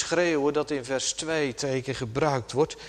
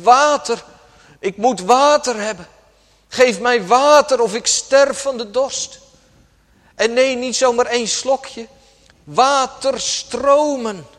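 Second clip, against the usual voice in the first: loud, higher desperate cry for water; thirsty deer's cry; 6 semitones higher, 7 dB louder than usual. Acted. Preacher voicing a dying deer, loud desperate cry. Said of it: "Het laat een schor kreet horen".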